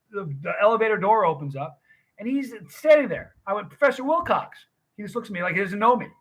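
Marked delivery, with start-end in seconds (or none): 3.7-4.6 surprised recognition. Retold or imitated. Spots old professor at elevator.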